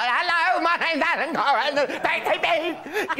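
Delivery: high-pitched panicked shouty introduction, hammed up; terrified stage entrance. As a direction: imitate yourself nervously blurting your stage greeting. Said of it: "I was just terrified".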